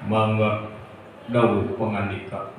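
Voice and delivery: very low, soft courtly assent; obedient minister. Acted. Minister accepting the king's order, low submissive voice. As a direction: yield humbly, low and hushed.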